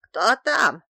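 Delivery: put-on high, loud, cracked old voice; hoarse, cracked voice. A narrator's reading. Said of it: "хриплый, надтреснутый высокий голос". (хриплый, надтреснутый высокий голос)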